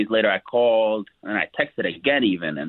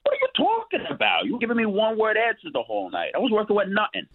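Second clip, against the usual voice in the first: much higher, heated protest; indignant outburst; 9 semitones higher than usual. Real, not acted. Stung man snaps back at the woman who ghosted him, indignant. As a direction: fire back, pitch jumping up in disbelief. Man argues with date on air.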